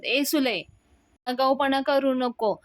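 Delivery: shouting rebuke. Retold or imitated. Anna scolding his sister Sule, shouted. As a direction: snap her back into line. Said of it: "अण्णा ओरडतात सुलक्षणावर".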